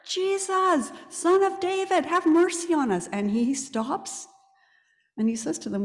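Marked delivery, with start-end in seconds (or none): 0.1-3.1 the blind men shout louder for mercy. Retold or imitated. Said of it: "They shout louder".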